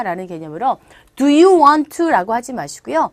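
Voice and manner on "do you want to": loud, higher Do you want to; blunt request. Shown as a demonstration. Teacher showing the form to avoid, blunt and loud. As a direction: throw out Do you want to forcefully.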